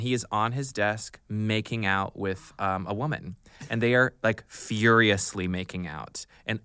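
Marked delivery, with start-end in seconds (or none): none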